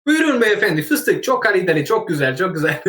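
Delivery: high Turkish market vendor pitch; Turkish accent. Retold or imitated. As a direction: call out like a Turkish bazaar seller. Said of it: "I use the Turkish accent".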